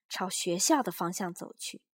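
plain reading voice

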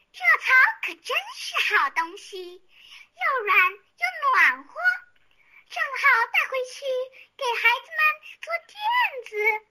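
high, pleased mouse voice, slowing at the end; a small mouse delighted with its find; smug little mouse. A narrator's reading. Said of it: "老鼠得意地想".

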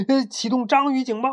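high-pitched story-character cry; shouted alarm. A narrator's reading. Bintuo raises the octopus alarm on seeing the monster, crying out. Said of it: "大叫道".

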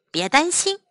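ordinary delivery

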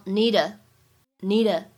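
"need a" slow, run together as one word; linked need a. Shown as a demonstration. Teacher showing the linking in "need a", slow. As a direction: join "need a" with no break, slowly. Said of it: "need a可以做连读".

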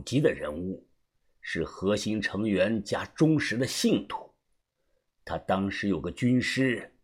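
low, hushed character voice; soft, low older mentor sharing a secret; knowing elder, assured. Acted. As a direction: explain it quietly and with certainty. Voices Uncle Xu explaining.

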